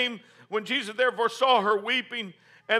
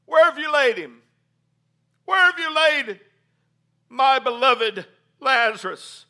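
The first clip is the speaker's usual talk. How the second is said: higher, louder, pleading Where; Jesus grieving; 5 semitones higher, 8 dB louder than usual. Acted. Jesus at the tomb asks where Lazarus lies, loud and anguished. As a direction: cry out for the beloved friend.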